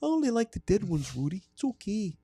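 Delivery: very high, creepy Wisconsin voice; chirpy high killer voice, staying in character; Ed Gein character. Acted. Host staying in his killer character for the cohosts.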